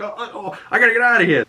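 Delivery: high, flustered mock panic; panicked flustering. Peacemaker realising the cops are outside, high mock panic. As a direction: scramble in a high voice. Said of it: "and he's like".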